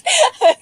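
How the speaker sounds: sudden high laugh burst; embarrassed laugh. Real, not acted. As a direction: giggle at own hiccup. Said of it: "I have the hiccups".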